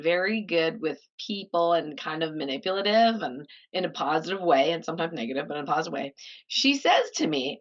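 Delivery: normal talking voice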